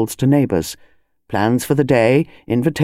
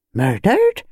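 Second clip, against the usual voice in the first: curious wife; 5 semitones higher than usual. Acted. Wife voiced in story.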